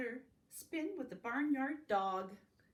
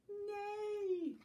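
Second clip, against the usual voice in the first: higher, slow neigh; 11 semitones higher than usual. Acted. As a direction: stretch the neigh like a horse. Horse sound in picture book.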